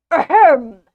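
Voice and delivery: loud, exaggerated "ahem"; over-the-top throat clearing. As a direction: clear your throat hugely to get attention. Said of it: "most over-the-top clearing of the throat".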